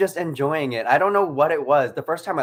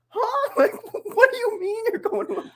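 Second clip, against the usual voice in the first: high, baffled disbelief; baffled high squeak. Retold or imitated. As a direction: squeak out in confused surprise. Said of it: "I was just".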